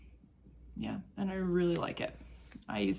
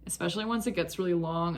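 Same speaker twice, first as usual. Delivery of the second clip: usual speaking voice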